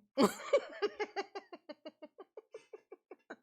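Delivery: drawn-out high cackle of laughter; long high laugh. Real, not acted. Lacht hard om eigen grap.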